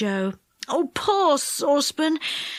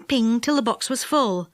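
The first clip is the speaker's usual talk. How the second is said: usual speaking voice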